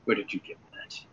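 very soft, feeble captive voice; faint, suspicious murmur; weak, hushed question. Acted. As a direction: ask it under your breath, with little strength. Weakened captive questions the player.

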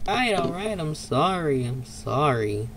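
ordinary talk

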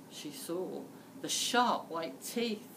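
usual speaking voice

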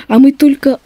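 usual speaking voice

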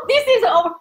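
high, laughing exclamation; laughing outburst. Real, not acted. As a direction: cry it out high through a laugh.

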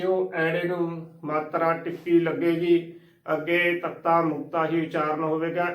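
ordinary talk

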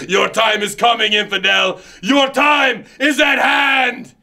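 booming villain proclaiming to infidels; megalomaniac rant. Acted. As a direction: preach your threats like a fanatic leader. Character promo rant.

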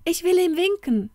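put-on girl calling out that Toni is outside; excited call. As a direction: blurt it out eagerly. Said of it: "rief Elsa aufgeregt".

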